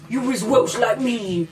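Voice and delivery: usual speaking voice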